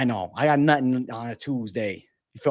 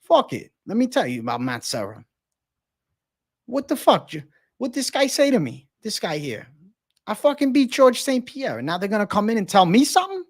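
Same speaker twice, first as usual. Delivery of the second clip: higher mock outraged New York rant; Matt Serra impression; 6 semitones higher than usual. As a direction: rant like a fired-up fighter. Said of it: "Can you do a Matt Serra impression?".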